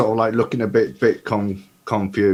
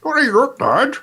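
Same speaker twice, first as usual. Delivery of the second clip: much higher, louder protest; defensive Studley; 7 dB louder than usual. Acted. Studley arguing with Ida, a high defensive protest. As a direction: whine it back defensively.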